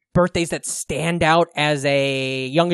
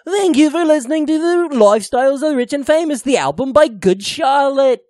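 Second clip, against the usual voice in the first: high, pompous TV presenter voice; Robin Leach impression; 9 semitones higher, 4 dB louder than usual. Host thanking listeners as Robin Leach, high presenter impression. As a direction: proclaim it high, drawing out the ending. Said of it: "is actually Robin Leach".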